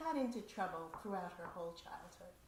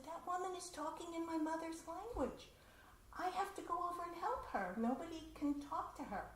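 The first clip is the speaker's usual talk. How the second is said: put-on urgent girl; 9 semitones higher than usual. Girl urgently tells the boys.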